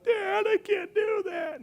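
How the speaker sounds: high, slow, whiny mock shout of a kid; whining kid. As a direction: whine it like a child refusing. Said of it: "giving me all this whining".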